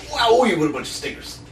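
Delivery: mock amazement. Comic mocking the people under them, hammed-up amazement. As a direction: exaggerate the wow, laughing at the underlings. Repeats it exaggerated, laughing.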